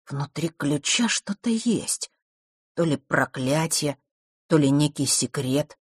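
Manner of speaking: slow, deliberate musing; drawn-out, thoughtful intrigue; mysterious pondering. A narrator's reading. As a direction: ponder the secret word by word. Pondering the key's hidden secret.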